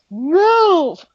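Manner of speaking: a sudden high, loud bark of "Move!"; shouted order. Retold or imitated. Emma shoving a Lost Boy out of her way, sharp yell. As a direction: shove the word out like pushing someone aside.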